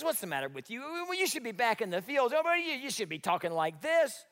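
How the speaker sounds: a taunting Eliab; mocking older brother. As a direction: sneer down at the little brother. Eliab mocking David, preacher voicing.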